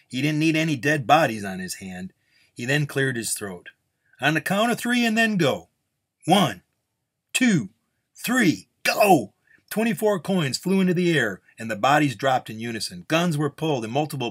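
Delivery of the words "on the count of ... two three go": high, loud starter's count; countdown call. A narrator's reading. Official starts the shootout before a crowd, calling out.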